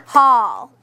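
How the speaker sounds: "Hall" said alone, long and falling; first syllable isolated. Shown as a demonstration. Teacher isolating the first syllable for pupils, drawn out. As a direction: hold "Hall" out as one full syllable. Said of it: "let's sound out the first syllable".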